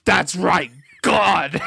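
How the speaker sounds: all caps. Shown as a demonstration. Narrator reading the typed all-caps line aloud, loud and high. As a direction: belt it out like shouted capitals. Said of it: "And in all caps".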